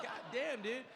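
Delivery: high, loud laughing god damn; laughing disbelief. Retold or imitated. Actor reacting to fans booing him, laughing disbelief. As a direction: laugh it off, stunned by the hate. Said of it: "I'm like".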